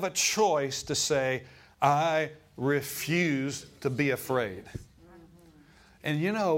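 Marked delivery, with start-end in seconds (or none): 1.8-4.6 slow declaration. Acted. Preacher declares refusal slowly.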